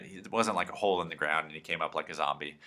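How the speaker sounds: ordinary talk, nothing marked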